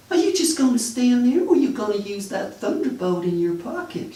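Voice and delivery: mock seductive woman. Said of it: "She laughed... she said".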